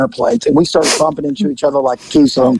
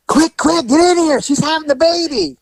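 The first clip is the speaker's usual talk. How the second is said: put-on high, urgent young girl voice; frantic girl; 14 semitones higher than usual. Frantic girl at the door to the officers, high and urgent. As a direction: mimic the panicked girl at the door. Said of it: "And she goes".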